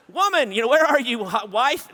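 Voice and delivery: loud, high call for the wife; mock calling out. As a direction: holler for help in a hammed-up way. Calls for his wife to help.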